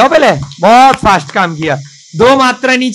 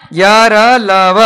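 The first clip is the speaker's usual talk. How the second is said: य र ल व spaced out very slowly; slow recitation; much slower than usual. Shown as a demonstration. Teacher reciting the alphabet slowly to the class. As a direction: recite the letters one by one, slowly. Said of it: "ल कैसे बनाएंगे?".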